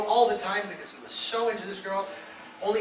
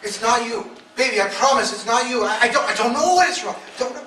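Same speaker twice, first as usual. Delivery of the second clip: high, loud, pleading husband; 6 semitones higher, 8 dB louder than usual. Retold or imitated. Husband pleading with his wife, loud and high. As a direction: beg her not to blame herself. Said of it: "And I'm going".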